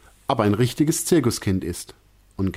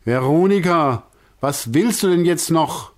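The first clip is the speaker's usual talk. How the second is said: irritated, a bit louder; quite cross; 7 semitones higher, 4 dB louder than usual. A narrator's reading. Director annoyed at Veronika tugging his arm, irritated. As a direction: snap at her, annoyed. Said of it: "klingt dabei ziemlich böse".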